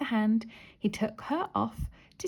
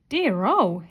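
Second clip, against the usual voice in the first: loud startled exclamation; louder back-to-front exclamation; exclaimed; 7 dB louder than usual. A narrator's reading. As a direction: blurt it out in surprise.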